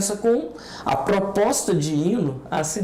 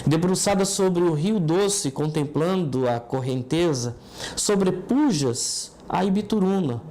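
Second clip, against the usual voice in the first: poem recitation; 4 semitones lower than usual. Acted. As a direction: declaim it like a civic ode. Recites the city anthem poem.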